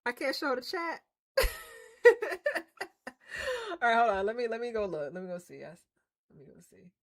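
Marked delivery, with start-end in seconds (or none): none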